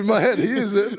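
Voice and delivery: high, loud, laughing delivery; amused and cracking up. Real, not acted. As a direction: talk through real laughter. Laughing about rapper switching languages.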